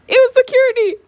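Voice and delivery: very high, loud exclamation; over-the-top high shriek; shrieked reveal. Acted. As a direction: squeal it out at full pitch. Dragged out by security.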